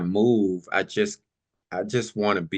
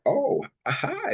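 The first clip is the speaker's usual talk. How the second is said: higher, stretched-out mock surprise; surprised greeting; 14 semitones higher, slower than usual. Stranger turns round and finds someone beside her, surprised. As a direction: imitate a startled, halting hello. Woman notices her sitting nearby.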